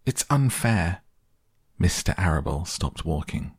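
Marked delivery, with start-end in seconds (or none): 0.0-1.0 higher-pitched, lightly tearful complaint; understated childlike wail; mild sobbing protest. A narrator's reading. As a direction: protest with a touch of upset. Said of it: "she sobbed".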